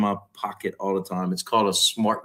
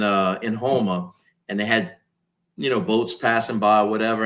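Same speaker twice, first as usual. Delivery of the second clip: ordinary talk, nothing marked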